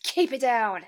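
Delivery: loud, cranky "keep it down"; grumpy half-asleep sleeper. Pretend. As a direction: snap at the noise, still half-asleep. Grumpy sleeper scolding the monsters.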